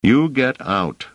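slow, emphatic officer's order; vehement order. A narrator's reading. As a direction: order the kid out, word by word. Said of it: "shaking the boy vehemently".

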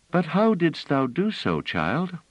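a hint of a tender musician; kindly woman. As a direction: answer a child kindly. Musician asks in wonder.

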